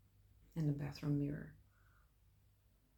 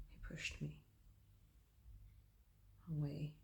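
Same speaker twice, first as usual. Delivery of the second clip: whispering; 9 dB quieter than usual. Acted. Violent attack recounted.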